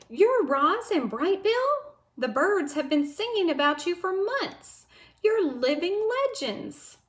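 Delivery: high, excited squeak; squeaky excitement. Acted. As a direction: squeak it out, thrilled to meet them. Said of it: "the voice squeaked with excitement".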